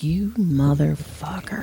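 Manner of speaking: low, slow retold curse; mock seething. Mother seethes while listening in.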